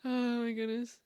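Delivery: usual speaking voice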